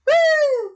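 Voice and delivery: short loud "woo" cheer; high whoop. Real, not acted. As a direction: let out a high, excited whoop. Cheers during setup.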